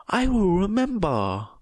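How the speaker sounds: a huge brute fawning on his little master; happy giant. A narrator's reading.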